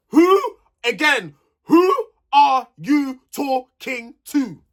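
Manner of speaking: high, loud "who", then word by word; slow emphatic challenge. Real, not acted. Vlogger confronting Curtis over Elma, slow emphatic challenge. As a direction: break "who are you talking to" into separate slow beats.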